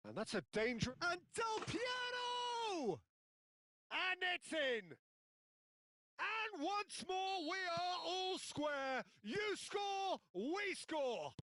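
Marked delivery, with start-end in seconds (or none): 1.1-3.0 Piero stretched high and slow; excited shout. Real, not acted. Big chance called. 3.9-11.3 high, slow crying shout of joy; goal shout. Real, not acted. Equaliser called.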